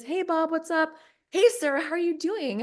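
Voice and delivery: high, upbeat retold hello; mock chipper greeting; imitating friendly greeting. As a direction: call out a lifted hello to acquaintances. Retold greeting from Australian dude.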